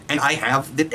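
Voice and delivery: ordinary talk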